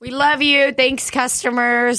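high, loud, slow goodbye; high cheerful sign-off. Real, not acted. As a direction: beam it out to fans.

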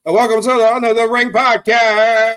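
loud, high announcer welcome; ringmaster. Acted. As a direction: boom it out like a ring announcer.

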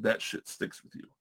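hushed, breathy whisper. Real, not acted. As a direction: drop to a whisper for emphasis. Repeats the point for emphasis.

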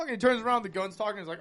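ordinary delivery

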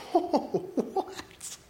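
high, airy, drawn-out gasp of shock; stunned disbelief. Acted. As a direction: breathe it out, stunned. Stunned witnesses to pigs drowning.

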